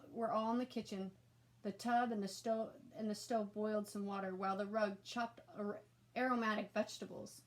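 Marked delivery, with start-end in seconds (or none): none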